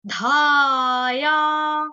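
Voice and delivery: धाया pushed loud and held long; forceful धाया. Shown as a demonstration. Teacher showing students where to put power, loud and drawn out. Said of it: "power लगानी है अपनी".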